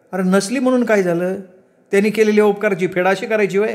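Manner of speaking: high-pitched, indignant village mother; rebuking mother. Acted. Mother rebuking her son for ingratitude, indignant.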